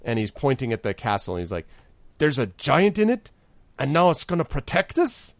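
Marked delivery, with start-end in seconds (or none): 2.2-5.3 can't believe his ears, voice shooting up; alarmed disbelief. Acted. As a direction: pitch climbing high in alarmed disbelief. Morak alarmed at giant plan.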